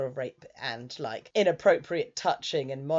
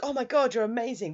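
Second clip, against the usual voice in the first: gushing praise; 7 semitones higher, 3 dB louder, faster than usual. Retold or imitated. Friend gushing over VR headset.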